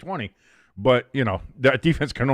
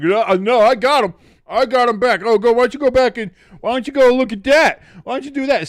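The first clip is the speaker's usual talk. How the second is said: high, louder cocky comeback; 11 semitones higher, 7 dB louder than usual. Pretend. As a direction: play the confident comeback. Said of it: "You have to be like".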